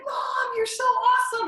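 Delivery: put-on high, loud excited little kid; imitating thrilled son. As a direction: cheer for Mom like a delighted child. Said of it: "my son thinks this is, like, the greatest thing ever".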